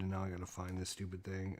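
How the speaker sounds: normal talking voice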